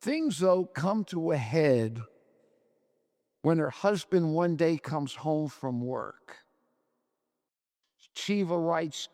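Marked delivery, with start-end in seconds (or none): none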